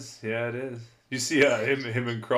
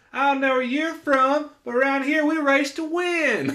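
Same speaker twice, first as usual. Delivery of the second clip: high, loud yelling rival racer with a southern twang; mock megaphone yell; 7 dB louder than usual. Third-place racer heckling the winner's interview, high loud yell. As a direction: bellow it at the crowd like a sore loser. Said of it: "just yelling at the crowd".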